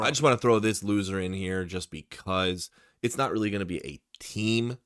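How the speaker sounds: usual speaking voice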